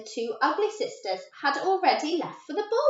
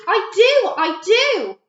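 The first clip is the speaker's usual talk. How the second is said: eager; 9 semitones higher, 10 dB louder, slower than usual. A narrator's reading. Gertie eager in story.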